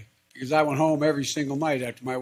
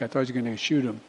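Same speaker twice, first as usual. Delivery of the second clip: usual speaking voice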